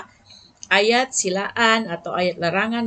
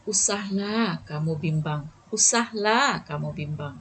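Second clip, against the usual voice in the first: soft soothing; 4 semitones lower than usual. Shown as a demonstration. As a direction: soothe a worried friend with usahlah kamu bimbang. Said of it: "lebih lembut dan melembutkan hati".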